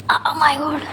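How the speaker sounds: soft whispered oh my God; real exhausted whisper; whispered exclamation. Real, not acted. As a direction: breathe it out in a tired whisper. Exhausted after long hospital day.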